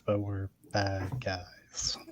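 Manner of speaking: very slow, hushed whisper; whispered aside. Acted. Hushed contradiction of the previous claim.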